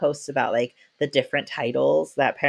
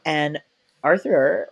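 normal talking voice

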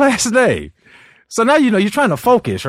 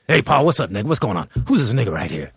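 Uncle Ray voice; 7 semitones lower than usual. Retold or imitated. Uncle Ray greets Paul in the green room, low and brash. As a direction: lower gruff greeting. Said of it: "he goes".

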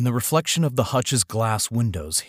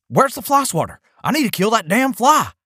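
put-on much higher and louder, a cranky old man yelling; angry husband; 13 semitones higher, 6 dB louder than usual. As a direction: bark it like a furious old husband. Husband demands fly swatter.

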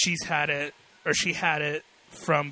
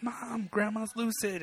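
retold calling out; 7 semitones higher than usual. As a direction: call for mom in a held-back, higher voice. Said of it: "I yelled for my mom".

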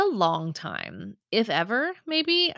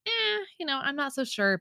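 higher-pitched, noncommittal "eh"; unconvinced; 7 semitones higher than usual. Retold or imitated. Homebuyer recalling doubts about house B, unconvinced. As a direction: voice mild doubt, starting high. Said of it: "the one that I'm like".

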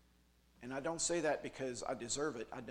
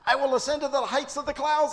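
much higher and louder each boast; climbing higher; 12 semitones higher, 14 dB louder than usual. Acted. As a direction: push the pitch up like climbing stairs.

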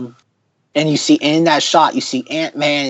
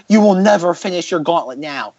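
normal talking voice

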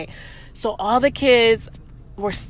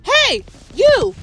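high, loud hey hey to wake someone; calling out; 9 semitones higher, 6 dB louder, much slower than usual. Retold or imitated. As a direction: call across the room to wake her. Nail tech waking sleeping woman.

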